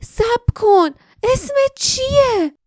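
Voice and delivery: very high, urgent call. A narrator's reading. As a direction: cry out after someone leaving, stretching the last words. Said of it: "جاسمین صدا زد". (صدا زدن با فریاد)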